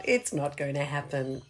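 low, soft newsreader delivery; news reader voice. Shown as a demonstration. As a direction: announce it like the evening news. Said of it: "best news reader voice there".

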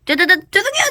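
stammered apology. Acted.

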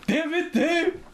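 exasperated yell. Retold or imitated. Acted quarrel on floor.

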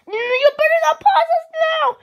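panicked cry. Acted. Friend frozen by the pause remote.

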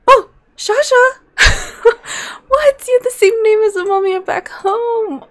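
high, loud delighted squeal with a laugh; excited squeal. Real, not acted. Player squealing at the mummy's familiar name. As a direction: shriek it out in delight.

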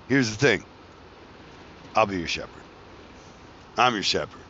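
usual speaking voice

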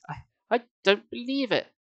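high, halting disbelief; stunned disbelief. A narrator's reading. Sari stares down at Uncle Ben, in stunned disbelief. Horrified disbelief, before the impression.

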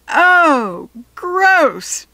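loud, high disgusted cry; grossed-out shout. Acted. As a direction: yell it out in disgust. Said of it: "she shouted out".

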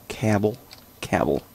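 "cabble" with the A of cab; "cabble" as CAB-ul, each one set apart; joke mispronunciation. Shown as a demonstration. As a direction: repeat "cabble" deliberately, rhyming with babble. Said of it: "That's how you say it".

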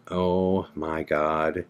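low, slow, soft retold horror; hushed dread. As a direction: let it sink in quietly and slowly. Retells dread at empty window.

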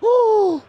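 loud, very high "oh!"; shocked cry. Real, not acted. Commentator reacting to Charé losing it, high shocked cry. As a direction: cry out in astonishment.

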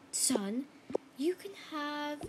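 slow hushed whisper. Pretend. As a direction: whisper it slowly. Hushed offer.

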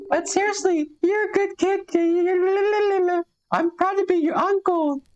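squeaky high cartoon uncle; high-pitched uncle voice. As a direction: play the proud uncle way up high. Voicing the uncle character.

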